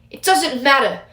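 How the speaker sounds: snapped. Acted. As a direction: shut him down sharply. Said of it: "Ferrex snapped".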